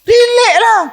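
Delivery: mock teasing. Preacher teasing the wailing wife, high and loud. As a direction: toss it off in a squeaky tease.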